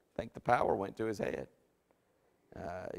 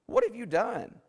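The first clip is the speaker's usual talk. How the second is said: high, rising cry of alarm; shocked prophet yelling at the king; What? What have you done? flung out high and loud; Samuel shouting in dismay; 12 semitones higher, 5 dB louder, slower than usual. Acted. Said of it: "and he goes".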